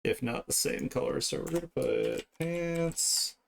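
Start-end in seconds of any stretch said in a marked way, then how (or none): none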